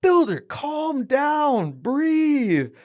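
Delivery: high, slow coaching call; coach calming. Retold or imitated. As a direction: stretch it out to settle a panicked fighter. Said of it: "They go".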